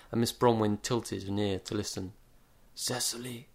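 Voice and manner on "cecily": whispering. A narrator's reading. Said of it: "He started to whisper something".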